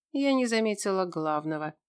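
usual speaking voice

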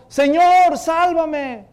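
high, loud cry for rescue; desperate shout. Acted. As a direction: yell for help while sinking. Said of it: "Y gritó".

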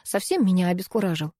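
ordinary delivery, nothing marked

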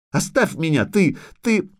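put-on high, spluttering child's protest; upset little girl. A narrator's reading. Little girl rebuffs the witcher, spluttering and high. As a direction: pipe up, lost for words.

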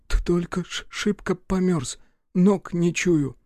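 put-on high child voice stuttering with cold; teeth chattering. A narrator's reading. Freezing boy tells Рух he's alive, teeth chattering. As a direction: chatter it through clenched teeth.

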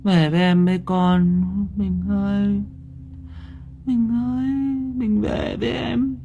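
keening murmur that climbs in pitch; delirious muttering. Acted. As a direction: call for a lost husband, faint and dazed. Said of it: "cô Hoa ngồi lẩm bẩm".

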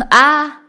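high, loud pained scream; scream of agony. Acted. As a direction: let out a shattering cry of pain. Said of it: "Đối phương la hét thảm thiết".

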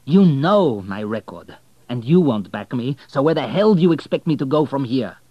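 loud, slowing on the first words; angry demand. Acted. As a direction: push back hard, exasperated. Abel's anger at the refusal.

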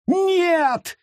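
very high shrieked no. Acted. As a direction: scream in terror. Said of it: "завизжал Гаррет".

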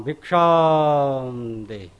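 very slow, solemn alms call; Brahmin boy begging. Acted. Vaman asks King Bali for alms, slow and solemn. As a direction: intone the alms request slowly. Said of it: "આવ્યા બલિ મહારાજના યજ્ઞમાં".

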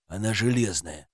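low, soft, understated; subdued reply. Acted. Policeman answering the angry wife about the door, subdued. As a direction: drop it low and quiet.

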